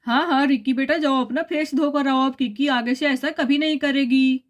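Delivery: put-on a father settling his children's quarrel; father character. Father reassures son. (समझाते पिता की आवाज़)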